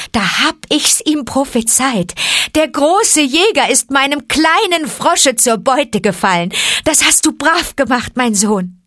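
a fierce mother wolf praising her cub; proud mother wolf. Acted. Mother wolf praising her son, proud and high.

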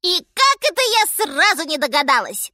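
high, loud monkey exclamation; shrieking little monkey. A narrator's reading. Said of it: "закричала обезьянка".

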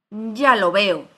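loud, commanding cut-in; loud interruption. A narrator's reading. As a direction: cut the speaker off with a shout. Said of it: "le interrumpió la reina".

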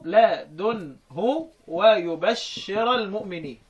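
"لدنه" drawn out slowly with the d silent; slow demonstration of the word; slow recitation. Shown as a demonstration. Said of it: "في الضم أسكن مشمه".

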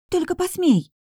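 high-pitched threatening outburst; alarmed, indignant dare; indignant warning. A narrator's reading. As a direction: warn them off sharply, pitch jumping up. Acted threat in sibling squabble.

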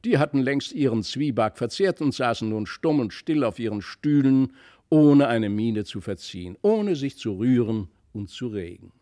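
plain reading voice

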